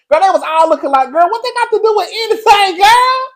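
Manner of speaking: high, loud, incredulous squeal; mock outraged squeal. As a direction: shriek it like the whole cast is scandalized. Acting out the women's reaction.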